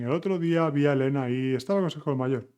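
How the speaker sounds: blur the sentence together fast like a native. Shown as a demonstration. Teacher mimicking a fast talker, very fast. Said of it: "very fast".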